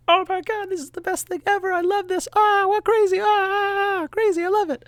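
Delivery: very high, loud squealing delight; giddy excitement. Retold or imitated. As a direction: squeal like an overjoyed cartoon fan. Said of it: "other times where I'm like".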